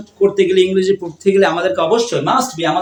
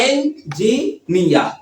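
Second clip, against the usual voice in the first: slow "engineer" in three separate parts; teacher's slow syllable demo; much slower than usual. Shown as a demonstration. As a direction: pause between each syllable of "engineer". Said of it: "কয়টা syllable?".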